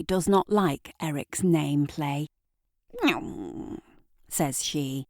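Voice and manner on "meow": low, soft grumpy meow; a lightly voiced sulky cat. Deirdre the cat answering Eric, grumpy meow. Cat's reply voiced by narrator.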